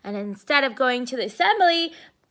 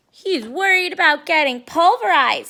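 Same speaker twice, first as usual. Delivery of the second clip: teasing little sister; 4 semitones higher, 7 dB louder than usual. Acted.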